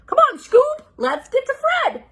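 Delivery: high loud call; urgent Shaggy. Acted. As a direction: hurry the dog along. Shaggy urging in story.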